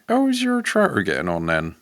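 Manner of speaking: put-on rustic farmer accent; countryside accent. Countryman asks his friend after the tractor, rustic accent. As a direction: ask it like an English country yokel. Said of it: "a genuine countryside accent".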